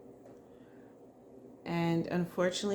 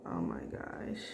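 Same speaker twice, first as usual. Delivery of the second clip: choked up; 12 semitones lower, 7 dB quieter than usual. Real, not acted. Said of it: "I'm getting emotional".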